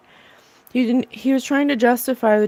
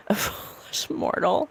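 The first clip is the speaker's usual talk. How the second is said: dark lord; 7 dB quieter than usual. Retold or imitated. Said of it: "then he said, "I want revenge, foolish mortal".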